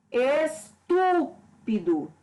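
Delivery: "Estúpido" very slow, syllable by syllable, TU strongest; stress on TU. Shown as a demonstration. Teacher showing the stressed syllable, slow "Estúpido" with weight on TU. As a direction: stretch out "Estúpido", punching TU. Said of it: "a sílaba tônica aqui que eu pronuncio com mais intensidade".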